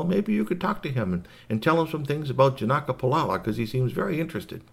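a hint of a chatty professor; genial doctor. Storyteller voices Doctor Goodlight.

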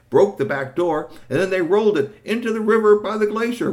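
thrill at the wild wrecking; gleeful. A narrator's reading. Narrator caught up in the trolls' fun, high and loud.

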